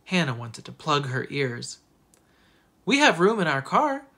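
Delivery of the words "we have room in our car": high, loud schoolgirl announcement; narrated know-it-all child piping up; announcing. A narrator's reading. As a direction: proclaim it for everyone to hear. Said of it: "Perfect Sally announced".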